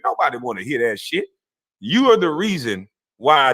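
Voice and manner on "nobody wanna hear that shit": ordinary delivery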